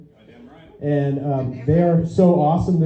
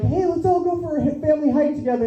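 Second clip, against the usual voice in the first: high, chirpy family-outing voice; imitated overeager parent; mock eager parent; 10 semitones higher, 4 dB quieter, faster than usual. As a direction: propose it in a put-on cheery high pitch. Voicing eager parent proposing hike.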